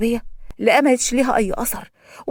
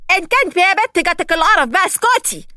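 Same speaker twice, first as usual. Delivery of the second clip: higher, snappy shush; harsh prisoner; 7 semitones higher, 3 dB louder than usual. A narrator's reading. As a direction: tell her to shut up. Another prisoner snaps shut up.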